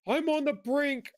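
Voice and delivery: hammed-up teary cry of despair; a caller at the end of his rope, voiced for effect; mock despairing caller. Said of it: "the guy was like".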